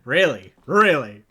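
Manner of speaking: loud, disbelieving really; incredulous really. Real, not acted. Streamer shouting at AI in disbelief. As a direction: blurt it out in disbelief.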